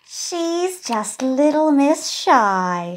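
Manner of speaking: teacher labelling a shy pupil, slow and loud. Acted. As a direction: announce it like a brisk teacher. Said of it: "says the teacher".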